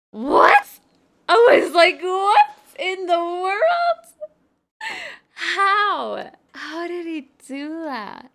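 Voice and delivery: high, loud cry of disbelief, drawn out; stunned outcry, slowing down at the end; shocked shout. Retold or imitated. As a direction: yell in total shock that he knew. Shocked he knew her cars.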